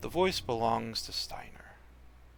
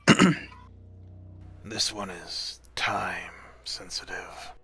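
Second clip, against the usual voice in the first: rasping whisper; 3 semitones lower than usual. Acted. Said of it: "wet, raspy whisper".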